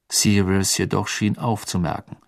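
ordinary delivery, nothing marked

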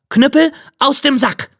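high, ringing magic command; called-out command. Acted. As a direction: call the cudgel out like an order. Geselle ruft den Zauberbefehl. (hoch gerufener Befehl)

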